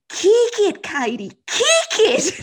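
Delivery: high, loud Australian coaching call; imitating Australian instructor. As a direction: holler it like an Aussie riding teacher. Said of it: "guy who used to say".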